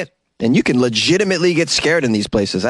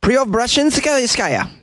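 ordinary delivery

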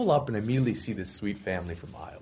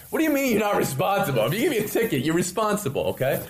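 high, heated protest; indignant; 9 semitones higher than usual. Retold or imitated. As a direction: argue it like it's obvious. Indignant rant at hotel.

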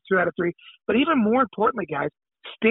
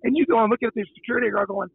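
usual speaking voice

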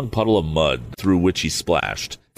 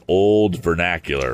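plain reading voice

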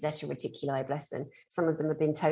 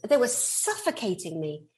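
normal talking voice

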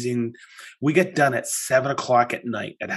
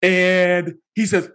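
ordinary talk

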